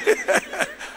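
high-pitched laugh; amused high cackle. Real, not acted. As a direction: burst into a high giggle. Amused at his own acting.

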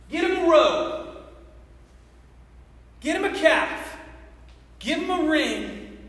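acted proud father calling out orders; joyful commands. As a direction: call out each order with a pause after. Father orders celebration for returned son.

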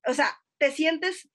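normal talking voice

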